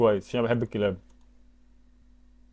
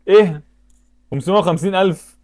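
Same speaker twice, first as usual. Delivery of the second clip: sudden high, loud "ايه؟" then a stunned question; can't believe the price; real shock at the number; shocked disbelief; 6 semitones higher, 5 dB louder than usual. Real, not acted.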